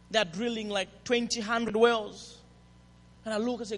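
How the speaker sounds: usual speaking voice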